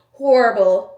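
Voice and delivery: horrible, punched loud; loud verdict. Acted. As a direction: slam the word horrible down hard. Listing bad old video quality.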